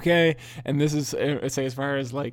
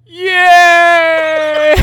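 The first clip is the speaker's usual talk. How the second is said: loud high cheer, broken by laughter; mock loud yay; 13 semitones higher, 15 dB louder than usual. Imitating dad cheering at failed tree lighting, loud yay. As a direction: yell yay as loud as you can. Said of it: "just loud as he fucking can".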